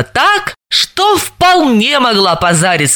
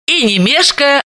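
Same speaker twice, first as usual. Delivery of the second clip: plain reading voice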